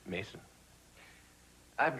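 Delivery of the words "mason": barely above a murmur; quiet. Real, not acted. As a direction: let the name drop away softly. Repeats the name, dropping away.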